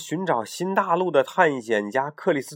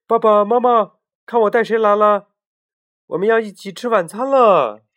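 louder, higher call home; 8 semitones higher, 9 dB louder than usual. Acted. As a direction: call the parents from afar. Rooster calls his parents.

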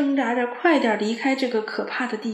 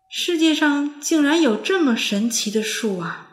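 higher, louder exclamation; marvelling; 5 semitones higher, 5 dB louder than usual. A narrator's reading. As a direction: gasp in wonder at the tree. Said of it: "汪汪感叹". (惊叹)

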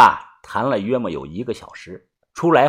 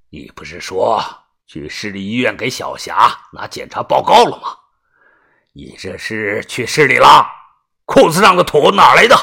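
stern scolding; 5 semitones lower than usual. Acted. Grandfather confronting his grandson over a lie, stern scolding. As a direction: start low, rise into an angry interrogation. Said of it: "厉声的呵斥".

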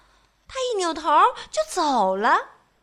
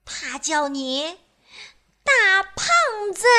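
teasing Miss Trouble; 7 semitones higher than usual. Acted. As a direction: drop the insult with glee. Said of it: "麻烦小姐看了看他，说".

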